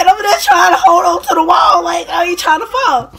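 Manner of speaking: frightened half-shout, voice close to crying; panicked yelling. Retold or imitated. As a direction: cry out like you're about to fall. Retelling her panic clinging to the wall.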